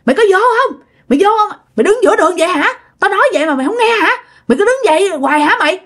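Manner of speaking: high-pitched, acted drunken rant; angry cursing. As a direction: berate someone who will not budge. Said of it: "Ổng tức lắm, ổng nói".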